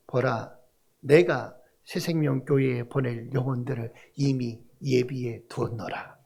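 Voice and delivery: low, soft solemn voice of God; lightly voiced divine proclamation. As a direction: speak as God, hushed and grave. Voicing God's word.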